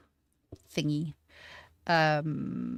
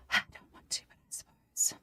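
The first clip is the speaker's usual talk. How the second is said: whispered grudging concession; reluctant whisper. Real, not acted. Streamer gives in to wrapping the handle, reluctant whisper.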